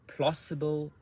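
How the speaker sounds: plausible with PLAU pitched high; word stress demo. Shown as a demonstration. Teacher models plausible for learners, stressed on high PLAU. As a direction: hit the first syllable of plausible high. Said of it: "part of the word that is stress".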